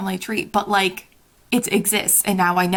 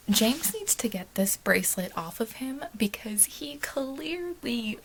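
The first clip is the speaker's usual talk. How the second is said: slow frustrated whisper; 7 dB quieter, slower than usual. Real, not acted. Frustrated at the bracelet, whispering. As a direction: whisper it urgently.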